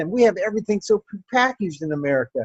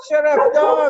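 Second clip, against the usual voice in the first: sharp, higher, louder scold; telling off the dog; 10 semitones higher, 6 dB louder, slower than usual. Real, not acted. Comedian telling her barking dog to hush, sharp scold.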